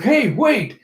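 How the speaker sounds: high-pitched interjection; acted protest. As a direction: cut in with a mock-alarmed call.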